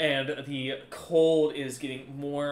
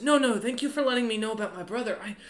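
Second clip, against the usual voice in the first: higher, quick voice of thanks; appreciative woman character, raised pitch; grateful woman official; 8 semitones higher than usual. Acted. Game master voices grateful woman.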